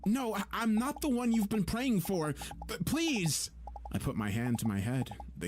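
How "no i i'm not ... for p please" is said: high and louder, frantic, stammering; desperate stammered pleading; panicked plea. Acted. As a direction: beg them to stop. Dizzy, panicked refusal.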